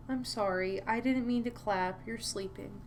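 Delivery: hushed murmur to a sleeping pet; soothing apology. Real, not acted. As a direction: apologise softly so it can keep sleeping.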